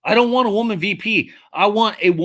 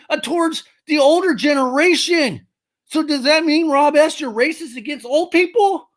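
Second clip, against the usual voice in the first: a raised, indignant scolding; exasperated rant; 6 semitones higher than usual. Real, not acted. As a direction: lecture Rob with mounting exasperation. Exasperated rant at chatter about age.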